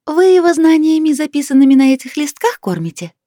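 high, loud chorus of fairies; high fairy voices. A narrator's reading. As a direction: ask brightly like tiny fairies. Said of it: "дружно уточнили".

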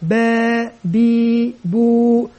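بو باء بي بو drawn out slowly; slow blending demo. Shown as a demonstration. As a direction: sound out each syllable slowly. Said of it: "بي بو واو مد طبيعي حركتان". (نطق بو باء بي بو ببطء)

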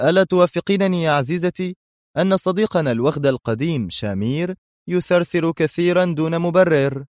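high-pitched, breezy mockery; sarcastic. A narrator's reading. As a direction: share a jibe about the fallen villain. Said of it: "وهو يقول في سخرية". (سخرية بنبرة مرتفعة)

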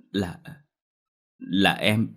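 faltering start with a stumble; hesitant. A narrator's reading. Younger sister falters before confessing. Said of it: "ngập ngừng một lúc mới dám lên tiếng".

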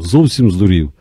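plain reading voice

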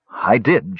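usual speaking voice

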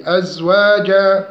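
أزواجا with evenly held long a vowels; recited أزواجا, measured; natural madd. Shown as a demonstration. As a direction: give each long a in أزواجا two beats. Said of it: "مد طبيعي".

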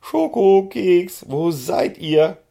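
high, loud call across the yard. A narrator's reading. The boss calls the missing ponies, loud high call. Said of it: "rief die Chefin".

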